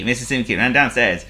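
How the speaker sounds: ordinary delivery, nothing marked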